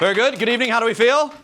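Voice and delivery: high, loud stage greeting; showman opening. Shown as a demonstration. Magician greeting his crowd, high and loud. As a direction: open the show big and bright. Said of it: "let me talk like this".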